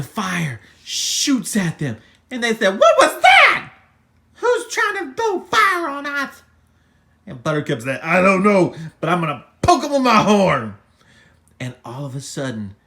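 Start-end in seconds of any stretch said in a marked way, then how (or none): 2.8-6.4 high, loud alarmed demand; outraged yell. Acted. Unicorns under fire, high outraged yell. 8.0-10.8 loud, quick fighting talk; feisty threat. Acted. Buttercup ready to poke attacker, loud feisty threat.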